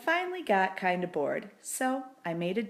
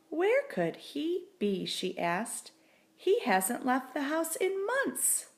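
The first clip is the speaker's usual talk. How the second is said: higher, slow puzzled wife voice; worried wife; 7 semitones higher than usual. A narrator's reading. Wife searching the house for her husband. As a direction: wonder aloud where he went.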